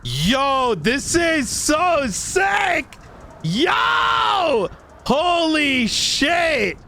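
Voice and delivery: high, slow, drawn-out shouts; hyped shouting. Real, not acted. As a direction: yell it out in pure hype. Hype reaction while gaming.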